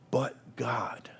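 hushed, breathy "But God" on a falling tune; a quiet, reverent whisper of "But God". Acted. Whispered refrain.

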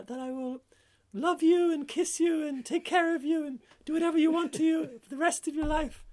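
very high, squeaky would-be princess; little frog voice. Acted. Frog offering the golfer a kiss bargain, high little voice. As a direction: plead in a tiny falsetto creature voice. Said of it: "And the frog says".

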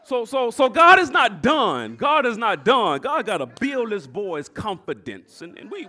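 ordinary delivery

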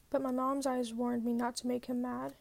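plain reading voice

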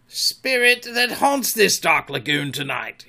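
slow, loud invocation. A narrator's reading. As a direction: cry out to an unseen spirit. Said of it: "Hook raised his voice".

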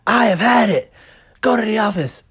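a teacher at her boiling point; angry teacher. Retold or imitated. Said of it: "And she'd go".

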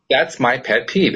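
usual speaking voice

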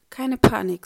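loud nervous self-reassurance; self-command. Acted. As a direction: snap at yourself not to panic. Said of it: "sagt er sich".

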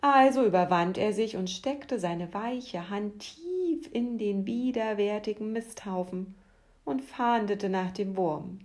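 plain reading voice

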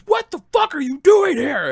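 high, loud angry drill sergeant; angry yell. Retold or imitated. Sergeant major confronting an old soldier, furious shout. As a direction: tear into a soldier who shouldn't be here. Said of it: "He goes".

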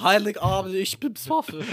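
put-on high-pitched Swiss German drunk. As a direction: squeal it like a drunk Swiss reveller. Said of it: "Er ist Schweizer".

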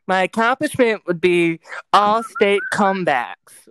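high, loud, drawn-out mimicry; imitating her voice. Streamer reads out the friend's typed answer, imitating her high and loud. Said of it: "I have to do her voice".

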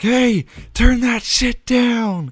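high, slow angry neighbor yell; cranky neighbor. Retold or imitated. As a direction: snap at the noisy kids like a fed-up neighbor. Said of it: "They're like".

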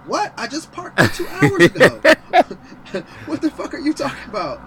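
high, loud, laughing disbelief; incredulous laughing rant; laughing outrage. Real, not acted. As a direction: crack up while yelling in disbelief. Outraged disbelief at the abandonment notice.

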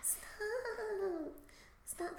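soft frightened whimper; cowering plea. Retold or imitated. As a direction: beg weakly in your sleep. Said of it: "that's how I imagine I sound when I sleep".

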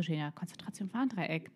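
hushed inner reminder; self-coaching. Retold or imitated. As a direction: tell yourself to focus, barely aloud. Retells telling herself to focus again.

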